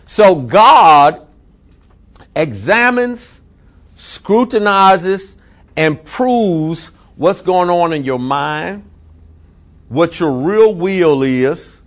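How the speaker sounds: very slow, each word weighed and drawn out; slow emphatic preaching. Acted. Pastor stressing God's scrutiny, very slow emphatic preaching. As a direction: lay every verb down slowly.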